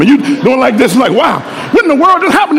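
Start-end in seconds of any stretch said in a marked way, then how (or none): 1.1-2.6 higher, fast amazed exclaim; can't believe the pass. Retold or imitated.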